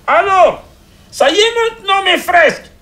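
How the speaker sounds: very high, loud shout. Acted. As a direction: yell it at the door. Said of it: "il s'enfuit à la porte et cria".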